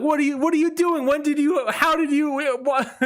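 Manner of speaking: high, quick, flustered imitation of the fiancée; fiancée stunned mid-proposal, mock high flustered voice; shocked rapid questions. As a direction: fire off the questions high in disbelief. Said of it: "she says it like".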